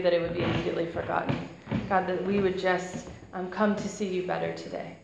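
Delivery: soft, hushed congregational prayer. Real, not acted. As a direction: pray quietly and humbly over the room. Opening prayer before the sermon.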